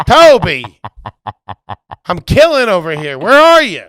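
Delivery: loud call across the room, high and shouted. Real, not acted. Host calls out loudly to absent producer Toby. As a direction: yell for Toby to come.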